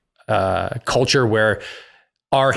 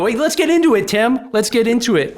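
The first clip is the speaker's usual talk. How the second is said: excited; 12 semitones higher than usual. Real, not acted. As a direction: jump in, fired up to argue. Excited call to dig into topic.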